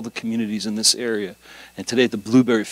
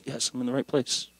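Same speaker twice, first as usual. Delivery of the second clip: much softer aside to yourself; quiet self-answer; 9 dB quieter than usual. Retold or imitated. Visitor reassuring himself at the church, quiet self-answer. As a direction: answer yourself under your voice.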